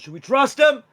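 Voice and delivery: high, loud shout to the group; yelled out. Shown as a demonstration. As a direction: call it out across the field. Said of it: "As you, like, yell it out".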